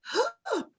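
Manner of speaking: sharp gasp of panic, retold; panicked gasp. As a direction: gasp as the bad news hits. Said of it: "Gros moment de panique". (halètement de panique)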